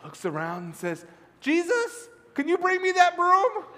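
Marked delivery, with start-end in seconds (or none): 1.4-3.6 put-on high, loud child voice; little boy calling out. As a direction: call hopefully into the dark. Boy calls into dark garage.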